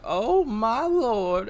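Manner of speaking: slow, drawn-out Southern swoon; Southern accent, fanning herself, swooning; breathy Southern belle sigh. Acted. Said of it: "Since when are we in Savannah?".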